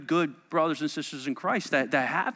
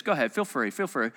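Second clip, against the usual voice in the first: muffled as if ears plugged; 5 semitones lower, faster than usual. Shown as a demonstration. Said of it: "Just listen to how I sound when you do this".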